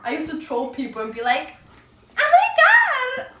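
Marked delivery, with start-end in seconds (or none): 2.2-3.3 put-on cutesy gamer girl, very high. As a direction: gush in a fake girly voice, stretching the words. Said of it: "like, a cutesy girl".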